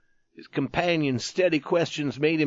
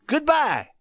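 much higher, curt farewell; hard voice; 12 semitones higher than usual. A narrator's reading. Youth leaves the tattered man, hard curt goodbye. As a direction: cut him off hard. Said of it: "spoke in a hard voice".

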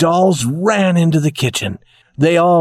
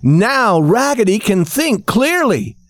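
cheering shout; 8 semitones higher than usual. A narrator's reading. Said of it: "shouted all the dolls".

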